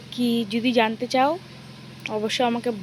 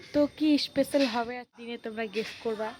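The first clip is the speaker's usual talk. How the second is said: ordinary talk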